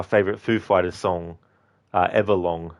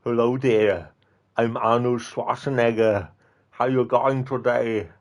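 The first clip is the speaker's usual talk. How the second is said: Arnold Schwarzenegger impression; 4 semitones higher than usual. Shown as a demonstration. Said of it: "with my normal voice doing it".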